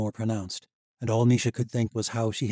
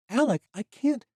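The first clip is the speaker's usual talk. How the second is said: put-on young woman voice; 5 dB louder than usual. A narrator's reading.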